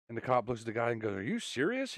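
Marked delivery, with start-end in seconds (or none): 1.2-2.0 high-pitched, softer disbelieving question; incredulous. Acted. Cop questioning the driver's absurd job, incredulous. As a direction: ask it in rising, hushed disbelief.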